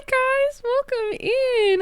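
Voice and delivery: high, slow drawn-out welcome; sing-song greeting. Real, not acted. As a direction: stretch the greeting out brightly. Streamer greeting chat arrivals.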